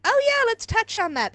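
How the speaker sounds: high mock-eager interjection; eager host. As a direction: pounce on it like a keen panel host. Voicing an eager panel host.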